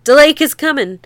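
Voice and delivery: mild hammed-up shout of warning; crying out. Fleeing people crying out warnings to the shanties, half-shouted. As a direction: call out the danger as you run. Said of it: "crying the same as they went".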